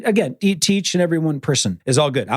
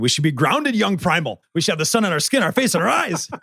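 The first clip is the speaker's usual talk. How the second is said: lightly hammed-up preachy guru voice, rising; teasing wife with a mock Liver King spiel; mock primal guru; 6 semitones higher than usual. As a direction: playfully preach like a wellness influencer. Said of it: "I looked at my wife and went".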